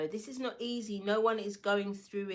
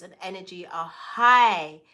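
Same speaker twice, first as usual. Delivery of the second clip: loud burst; 12 dB louder than usual. Real, not acted. Burst of volume on reading.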